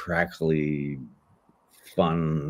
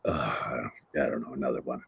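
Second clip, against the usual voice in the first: imitating a jaded director; 7 semitones lower, 8 dB quieter than usual. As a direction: mimic a bored man behind the glass. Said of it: "he's sort of looking like".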